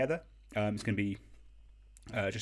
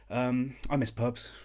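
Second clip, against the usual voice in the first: mock emotional; 4 semitones higher, 4 dB louder, slower than usual. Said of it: "I felt very emotional there".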